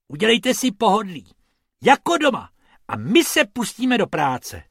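high, loud and quick host voice; rabbit announcing. Acted. Bob invites the tourists to relax, high and quick. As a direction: play the cheerful host, fast and up. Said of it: "oznámil Bob".

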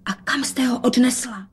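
forceful stage whisper. A narrator's reading. Daughter secretly questions mother about the cat, whispered. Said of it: "dodala šeptem".

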